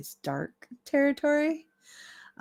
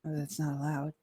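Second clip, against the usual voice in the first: softly muttered disapproval; muttering; 6 dB quieter than usual. Acted. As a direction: mutter it under your breath. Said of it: "and he mutters".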